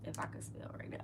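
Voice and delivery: breathy whisper to herself while typing; barely voiced mutter, mostly air; whispered aside. Real, not acted. Podcast host mutters to herself over her spelling, whispered.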